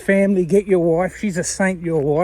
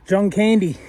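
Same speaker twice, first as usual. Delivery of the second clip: ordinary talk, nothing marked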